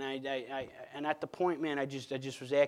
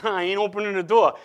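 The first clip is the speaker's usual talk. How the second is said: louder stubborn refusal; 5 semitones higher, 10 dB louder than usual. Retold or imitated.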